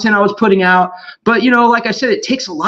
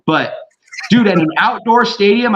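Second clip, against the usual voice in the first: ordinary talk, nothing marked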